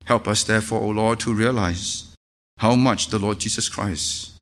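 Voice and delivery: low, soft, reverent prayer voice. Acted. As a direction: pray humbly for the congregation. Leads closing prayer.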